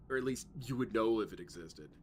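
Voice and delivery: normal talking voice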